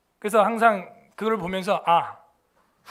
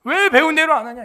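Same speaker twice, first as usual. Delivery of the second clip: higher and louder rebuke; tiger coach scolding; 6 semitones higher, 6 dB louder than usual. Retold or imitated. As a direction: snap at the kid like a tiger. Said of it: "호랑이가 되는 거야".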